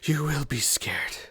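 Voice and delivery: hushed and threatening; menacing whisper. Acted. Prisoner whispering a threat to Xavier, menacing whisper.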